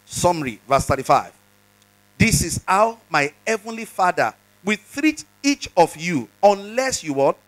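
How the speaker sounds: ordinary delivery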